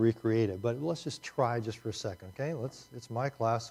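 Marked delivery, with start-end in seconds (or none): none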